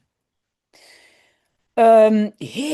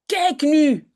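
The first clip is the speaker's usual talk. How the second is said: angry; 6 semitones higher, 4 dB louder than usual. A narrator's reading. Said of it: "zegt moeder boos".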